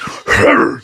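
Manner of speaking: short mock beast growl; imitated gorilla growl. Silverback bursting out of police car, mock growl. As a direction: growl like an ape lunging out.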